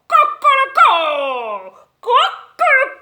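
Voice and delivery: slow, loud rooster crow, rising. Acted. Rooster crowing at sunrise, loud and drawn out. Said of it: "Gallo se despertó y cantó".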